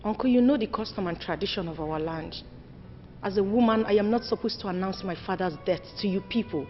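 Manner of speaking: low, soft, measured account; respectful explanation. Acted. Daughter explaining custom to her uncle, subdued and respectful.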